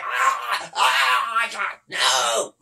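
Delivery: drawn-out mock cries for mercy; mock begging. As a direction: imitate someone choking and begging. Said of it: "He's just begging".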